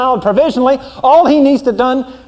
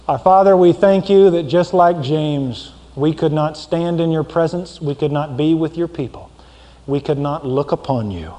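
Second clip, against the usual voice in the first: preacher opening the closing prayer, softer; 8 semitones lower, 4 dB quieter than usual. Real, not acted. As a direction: pray humbly to the Father.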